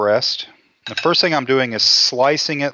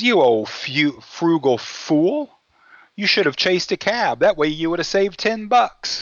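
scolding wife; 5 semitones higher than usual. Retold or imitated. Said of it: "she goes".

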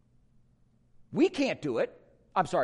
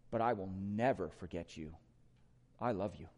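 tender promise; 11 semitones lower, 6 dB quieter than usual. Retold or imitated.

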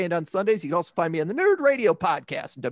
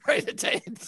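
breathy laughing words; 6 semitones higher, 5 dB quieter than usual. Real, not acted. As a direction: say it through laughter. Laughing about the punch.

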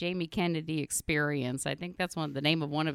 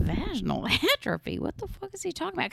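higher, fast, laughing disbelief; baffled; 11 semitones higher, faster than usual. Retold or imitated. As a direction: fire off the question in shock. Hearing a baffling diagnosis.

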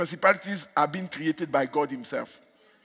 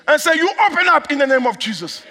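high, raised pulpit command; full-force commanding prayer; 10 semitones higher, 10 dB louder than usual. Real, not acted. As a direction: decree it insistently.

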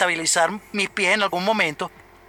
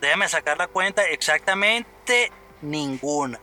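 mock counting; 3 semitones lower, slower than usual. Comic slow count, punchline.

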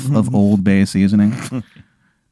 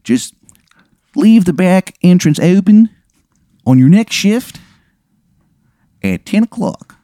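higher, hammed-up bad Maryland accent; 8 semitones higher than usual. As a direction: give the heist order in an awful Maryland accent. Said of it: "the worst Maryland accent you have ever heard".

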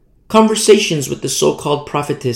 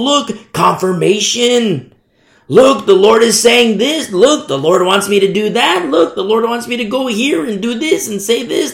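higher, louder mock amazement; gullibly excited follower; 5 semitones higher, 3 dB louder than usual. Follower finding confirmation in everything, mock excitement. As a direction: gush over every sign, pitched up.

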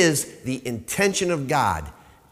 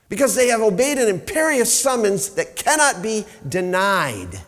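preaching; 6 semitones higher, 6 dB louder than usual. Acted. Sermon builds again.

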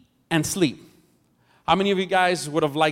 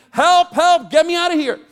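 high, loud screams for help; frantic yelling, voice cracking high; panicked screaming; 11 semitones higher, 6 dB louder than usual. Acted. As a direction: scream like a man facing a lion. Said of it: "screaming and yelling".

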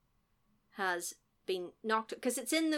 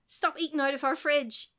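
raised, mildly cross dad voice; imitated telling-off, a little higher; mock yell; 5 semitones higher, 4 dB louder than usual. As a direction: scold with pretend annoyance. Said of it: "And then he yelled at him".

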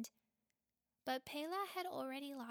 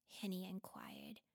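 ordinary delivery

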